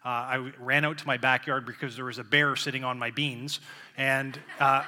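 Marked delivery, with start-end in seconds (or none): none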